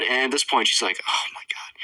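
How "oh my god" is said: unvoiced exasperated whisper; whispered disbelief. Girl reacts to boy's confession, whispered disbelief. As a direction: whisper it under the breath.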